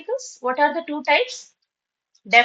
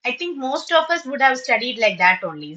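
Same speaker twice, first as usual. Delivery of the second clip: ordinary talk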